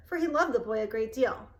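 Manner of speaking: usual speaking voice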